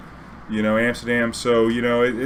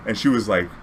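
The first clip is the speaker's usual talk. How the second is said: usual speaking voice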